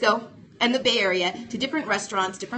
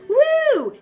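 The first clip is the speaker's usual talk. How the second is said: high loud whoop; party-hostess cheer; excited woo; 7 dB louder than usual. Real, not acted. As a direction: whoop to kick off the show. Hostess cheering show opening.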